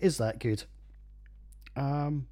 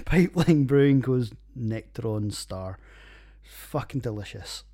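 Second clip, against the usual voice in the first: ordinary talk, nothing marked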